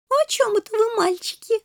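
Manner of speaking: put-on very high twittering girlish voice; chirping little princess. A narrator's reading. Shelli butting into the boys' talk, chirping high voice. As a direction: chirp it sweetly and curiously. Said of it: "тут же защебетала Шелли".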